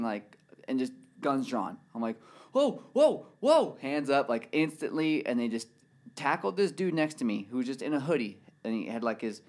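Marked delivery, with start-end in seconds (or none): 2.5-3.8 high, loud startled whoas; alarmed whoas. Retold or imitated. Man waking to drawn guns, alarmed cries. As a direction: re-enact sudden alarm, pitch leaping up.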